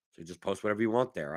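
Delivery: ordinary delivery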